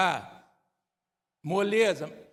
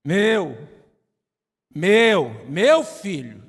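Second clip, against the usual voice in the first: louder, insistent "Meu. Meu. Meu filho." said as a father's claim; possessive shout; 3 semitones lower, 9 dB louder, much slower than usual. Acted. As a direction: bark "Meu" like a protective dad.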